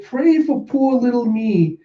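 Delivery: high, slow self-pitying whine. Retold or imitated. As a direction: beg for pity, drawn out. Self-pitying character line.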